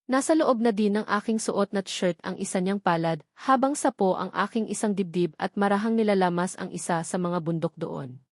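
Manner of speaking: ordinary delivery, nothing marked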